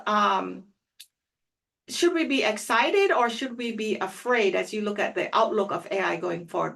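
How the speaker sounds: normal talking voice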